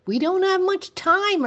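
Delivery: urgent plea, pitched high. A narrator's reading. Teacher urging the sheriff to act, urgently. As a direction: press him to hurry. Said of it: "urged Catherine".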